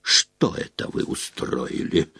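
low, soft growl of fury; angry demand kept under the breath; restrained roar. A narrator's reading. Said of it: "взревел он".

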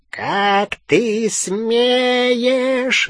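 high, very drawn-out growl; snarl. A narrator's reading. Fenrir defies Bellatrix while immobilised, snarling. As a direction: growl it slowly in outrage. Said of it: "зарычал он в ответ".

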